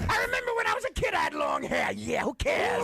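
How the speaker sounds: high shouted rock-singer rant. Retold or imitated. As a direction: yell it like a stadium frontman. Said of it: "that's all he says".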